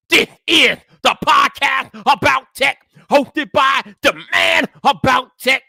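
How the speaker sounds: loud, high announcer call; big energetic host welcome; hyped podcast intro. Acted. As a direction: open the show like a radio host.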